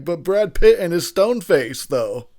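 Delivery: high, loud, drawn-out mimicry; mock fan voice. Commentator mimicking everyone who praises the actor, mocking fan voice. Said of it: "everyone's like".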